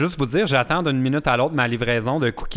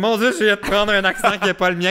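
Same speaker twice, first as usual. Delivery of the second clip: higher, alarmed inner voice; mock shock; 8 semitones higher, 3 dB louder than usual. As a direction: replay your own surprise, hammed up. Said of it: "je suis comme".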